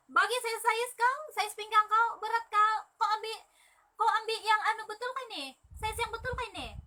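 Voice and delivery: high-pitched, pushy retelling of her own demand; loud mock-insistent voice asking for the size; retold insistent demand. Sister seller demanding her sister's size, high and pushy.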